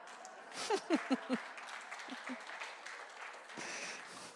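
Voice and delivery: airy unvoiced laughter, trailing off; breathy laugh. Real, not acted. Minister laughs at her own joke, breathy and soft. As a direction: laugh softly at the punchline. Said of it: "That's really funny".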